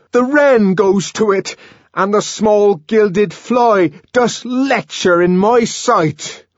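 high, loud, slow theatrical verse; ranting Shakespearean recital, frenzied; raging savage declaiming. Acted. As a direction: declaim bitterly at full voice.

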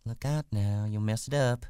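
uneasy warning. Acted. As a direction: warn him off, not yet angry. Wife warns before growing angry.

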